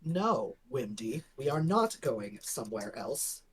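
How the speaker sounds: normal talking voice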